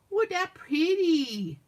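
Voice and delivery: high, slow, drawn-out playful gushing; exaggerated admiration. Crafter showing off her finished piece, hammed-up admiration. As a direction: stretch it out, hammed-up and laughing.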